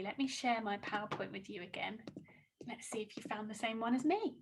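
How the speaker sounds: soft unvoiced whisper, quick. Real, not acted. Teacher whispers to the class while sharing slides. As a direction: hush it while setting up the slides.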